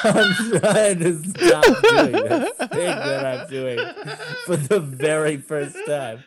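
slow, high, laughing. Real, not acted. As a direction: laugh through the words slowly. Laughing through the joke.

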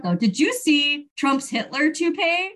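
usual speaking voice

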